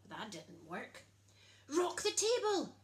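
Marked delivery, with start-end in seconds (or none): 1.7-2.8 much higher, louder call; shout. A narrator's reading. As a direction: call it out across the table. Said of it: "shouted the twin guests".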